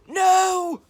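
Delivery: very loud high "No!"; defiant shout. Acted. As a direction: blurt a stubborn refusal. Ned nearly refuses.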